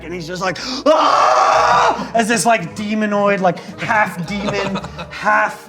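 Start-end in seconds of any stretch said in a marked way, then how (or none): 0.9-2.1 high loud scream; choked scream. Acted. Clerk screams as claws close round his neck, high and loud.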